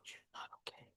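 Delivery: barely voiced whisper; whispered concern. Doctor to the injured boy, whispered concern. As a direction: whisper it, very softly.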